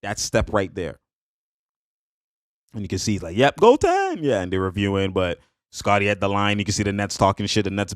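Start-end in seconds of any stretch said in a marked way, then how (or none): 3.4-4.2 high, loud mock yelp; mimicked goaltend call. Imitating the player calling goaltend, high mock shout. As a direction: shoot the pitch way up, mimicking the call.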